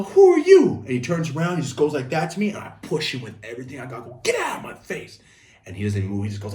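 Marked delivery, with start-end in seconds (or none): 0.0-0.8 high, loud indignant yell; kid's shrill playground challenge; indignant retort. Retold or imitated. Acted argument between fourth graders. 4.2-5.2 high-pitched angry yell; acted playground outburst. Kid shoving bigger kid, acted.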